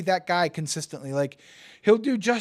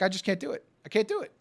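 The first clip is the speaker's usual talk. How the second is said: ordinary talk, nothing marked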